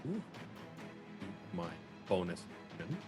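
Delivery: ordinary talk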